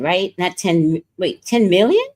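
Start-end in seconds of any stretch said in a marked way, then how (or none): none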